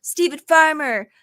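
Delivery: lightly hammed-up troll popping in to gloat; Stephen Farmer. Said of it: "he'll pop up and be like".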